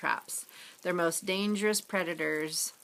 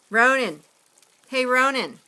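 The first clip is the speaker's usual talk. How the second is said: loud call across the beach, higher on the name; 6 semitones higher, 8 dB louder than usual. Acted. Dad yelling for his son from the top of the stairs.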